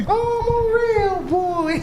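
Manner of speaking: very high, slow puppet voice; Pinocchio voice. Pretend. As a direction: stretch it out like a puppet boy. Repeats Pinocchio line higher.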